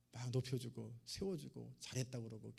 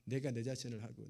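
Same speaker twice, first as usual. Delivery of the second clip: ordinary delivery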